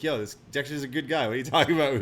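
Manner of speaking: loud, high mock ref voice; quoted ref. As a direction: yell it like a ref breaking character. Zombie ref breaks character.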